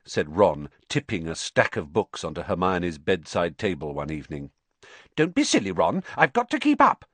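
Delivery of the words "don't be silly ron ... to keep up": brisk. Acted. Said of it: "said Hermione briskly".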